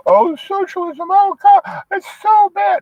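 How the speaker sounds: high falsetto mock horror; squealing parody of people fearing socialism; mocking whiners.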